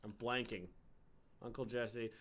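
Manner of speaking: ordinary talk, nothing marked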